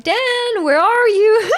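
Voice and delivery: high, loud call with a laugh. Pretend. Owner calling for their dog Dan, loud and high. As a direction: shout for the dog through the house.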